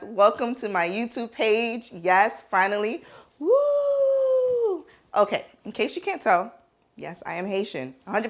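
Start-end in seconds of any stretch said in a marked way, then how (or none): 3.4-4.9 high, whooping cheer; joyful high whoop of triumph; excited whoop. Real, not acted. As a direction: let out a big celebratory woo. Celebrating finally launching the channel.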